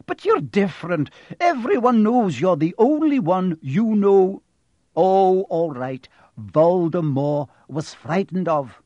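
exasperated but admiring insistence; half exasperated, half admiring. A narrator's reading. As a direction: admire them with a touch of exasperation. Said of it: "sounding half exasperated, half admiring".

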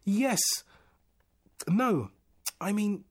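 high, loud, halting outbursts; flustered. A narrator's reading. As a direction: blurt each answer, correcting yourself. Flustered man contradicts himself.

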